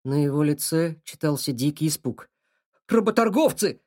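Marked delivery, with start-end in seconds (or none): 2.9-3.8 loud, frightened cry; shouted alarm. Acted. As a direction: shout the warning out. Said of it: "выкрикнул он".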